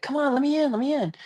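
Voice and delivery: high, whiny plea to be let in; frustrated driver begging for a gap; pleading in traffic. Retold or imitated.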